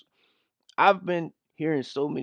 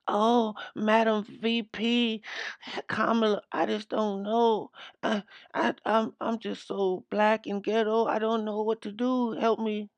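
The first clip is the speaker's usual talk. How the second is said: mocking ditzy voice; 8 semitones higher than usual. Commentator mocking the skit host as helpless, high whiny. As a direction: whine it like a helpless airhead. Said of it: "how ignorant these two are attempting to sound".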